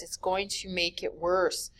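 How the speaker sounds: ordinary talk, nothing marked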